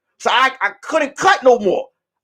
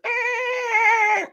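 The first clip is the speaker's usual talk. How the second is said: high grunt; 12 semitones higher, 5 dB quieter than usual. Retold or imitated. Someone opens the door.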